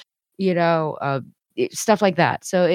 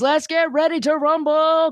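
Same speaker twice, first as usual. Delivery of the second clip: wrestling announcer; 9 semitones higher than usual. Retold or imitated. WWE announcer imitation.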